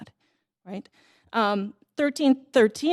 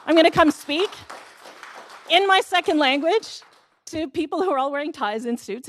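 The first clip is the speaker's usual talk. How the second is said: higher, louder anxious tenant voice; voicing nervous tenants; 5 semitones higher, 3 dB louder than usual. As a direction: channel the frightened tenants. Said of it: "They're like".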